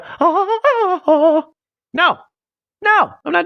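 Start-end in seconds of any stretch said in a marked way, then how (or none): 0.0-1.4 high, slow cackling ah-ah-ah; drawn-out high cackle; mock laugh. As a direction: ham up a cartoon laugh, pitched way up. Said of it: "you want me to do the".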